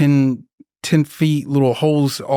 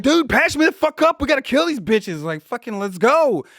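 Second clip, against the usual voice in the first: high, pumped urging to patch up and fight; hyped up, pushing to get back in; urgent battle order; 10 semitones higher than usual. Retold or imitated. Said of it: "I was just like".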